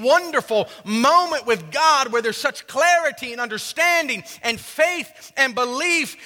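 high-pitched, swelling preacher's cry; impassioned preaching. Real, not acted.

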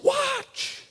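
put-on high, eager child's call of watch; a child begging to be seen, sung out high; kid's shout. Kid at the pool calls to a parent, high and eager.

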